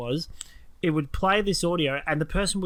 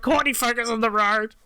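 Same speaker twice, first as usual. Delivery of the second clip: raised, higher urgent plea; mock scolding; 7 semitones higher than usual. Husband telling Courtney to watch the road, raised. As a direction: tell the driver off, half-laughing. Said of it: "I'm like".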